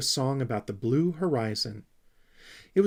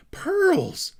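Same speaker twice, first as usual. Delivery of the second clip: excited outburst; 8 dB louder than usual. A narrator's reading. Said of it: "burst out the Snork Maiden excitedly".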